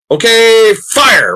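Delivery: high, loud mock battle order; ant commander. The ants launching their ambush, a loud mock command. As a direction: bark the attack order like a little general. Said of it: "you know".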